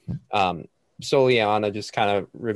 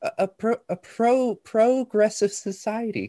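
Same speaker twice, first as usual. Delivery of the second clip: much higher, pitched-up NPC voice with laughs breaking through; weird high character voice; 12 semitones higher, 7 dB louder than usual. Acted. GM voicing Soliana as players laugh, squeaky high character voice. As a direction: squeak it out like a fussy scholar. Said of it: "Your voice sounds weird".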